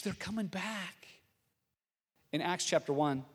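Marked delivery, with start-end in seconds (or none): 0.0-1.1 soft, slow hushed reassurance; comforting murmur, drawn out; soothing whisper. Acted. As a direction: gently hush a child. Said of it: "whispers those words to the child".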